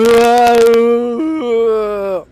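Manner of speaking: very slow, loud, high wail; anguished wail. Real, not acted. Wailing loudly over a remembered breakup. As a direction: let out a long wail of despair. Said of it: "テンション下がるようなこと思い出しちゃった".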